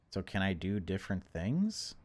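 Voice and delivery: ordinary talk, nothing marked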